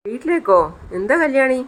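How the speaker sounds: high, startled disbelief; surprised question. Retold or imitated. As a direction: voice leaps up in shock. Kiran startled by wife's request.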